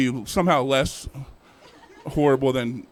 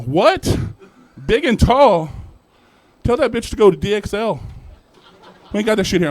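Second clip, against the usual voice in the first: shouted from the back room, high and loud; a stockroom guy yelling; loud yelling clerk; 8 semitones higher, 8 dB louder than usual. Retold or imitated. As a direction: holler across the store. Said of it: "You hear Kyle".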